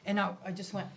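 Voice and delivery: normal talking voice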